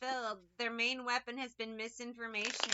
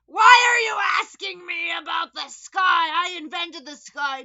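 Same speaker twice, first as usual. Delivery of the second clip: shouted, much higher, indignant; mock outraged shout; 7 semitones higher, 19 dB louder than usual. Imagined interrogated person yelling back, hammed-up fury. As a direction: yell it like an offended know-it-all. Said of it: "And they're just like".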